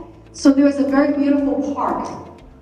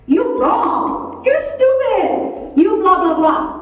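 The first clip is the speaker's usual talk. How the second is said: high, slow, sneering put-down; mock blaming; 8 semitones higher, much slower than usual. Inner voice pointing and blaming others, mocking sneer. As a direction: mimic a petty blamer, pitched way up.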